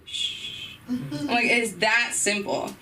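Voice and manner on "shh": soft unvoiced shush; crowd shush. Crowd at the frat party answering, soft shush. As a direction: hush like a whole crowd. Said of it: "the whole crowd said".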